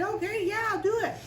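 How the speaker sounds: high, chirpy mock willingness; eager agreement. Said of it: "you think he said".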